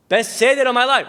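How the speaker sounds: acted indignant excitement; insistent mother. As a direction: push back loudly at a doubter. Mother insists against her doubting son.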